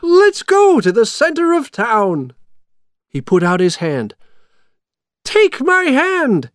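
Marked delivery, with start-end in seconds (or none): 0.0-2.3 high, loud, cheery mayor; rally them gladly, pitched high; happy. A narrator's reading. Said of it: "the mayor said happily". 5.2-6.5 high, slow, loud call; high-pitched, insistent mayor's cry. A narrator's reading. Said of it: "he cried".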